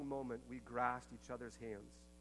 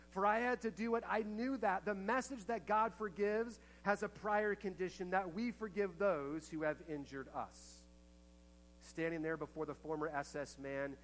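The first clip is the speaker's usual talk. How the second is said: higher and louder, impassioned sermon voice; preaching at full force; 6 semitones higher, 7 dB louder than usual. Acted. Preacher retelling a survivor's memory to the congregation, impassioned and loud. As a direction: preach her words out to the whole room. Preacher drives home forgiveness point.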